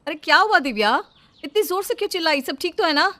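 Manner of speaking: loud, high worried questions; sister-in-law calling out in alarm; alarmed concern. Acted. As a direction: rush in asking what happened.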